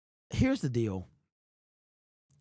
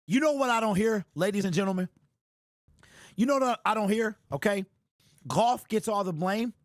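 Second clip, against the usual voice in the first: rising rant; 6 semitones higher than usual. Real, not acted. Ranting fan builds to outrage.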